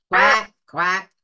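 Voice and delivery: loud quacking duck; a loud quack from the pond. A narrator's reading. Storyteller voices the ducks in the pond, loud quacking.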